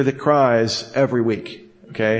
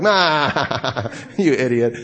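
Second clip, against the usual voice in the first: laughing mock jeer; much slower than usual. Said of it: "everyone's like".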